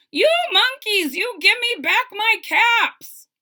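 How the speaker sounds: high, loud angry demand; angry peddler. Acted. Angry peddler shouting up at the thieving monkeys, high and loud. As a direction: shout up at them, fed up. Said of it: "This made the peddler angry".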